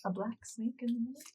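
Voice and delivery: hushed, hesitant question to a friend; whispering. Acted. Player's character quietly asks Greta about a banner, whispering. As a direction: drop to a whisper, fishing for the answer. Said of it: "so she'll whisper to Greta".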